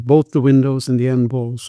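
usual speaking voice

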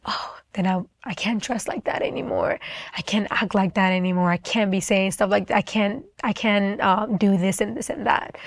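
softly voiced inner doubts; quiet worried thinking. Retelling second thoughts about going up to the altar, quiet worrying.

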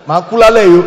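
laughing out loud through the words; loud laughter. Real, not acted. Preacher to congregation, laughing loudly at his own joke.

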